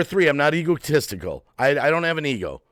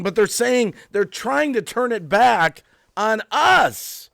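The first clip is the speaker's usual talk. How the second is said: much higher, worked-up outburst; agitated rant; 7 semitones higher than usual. Real, not acted. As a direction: pour it out in rising agitation. Building, agitated rant to viewers.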